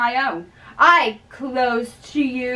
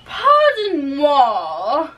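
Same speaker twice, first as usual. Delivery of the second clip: mock French; 4 semitones higher, much slower than usual. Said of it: "people who go around saying".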